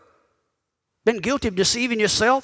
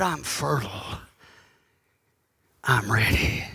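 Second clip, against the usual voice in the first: much lower and softer, a hushed inviting voice; low sultry murmur; 12 semitones lower, 6 dB quieter than usual. Retold or imitated. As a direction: drop the voice right down and purr it. Preacher echoes seductive quote.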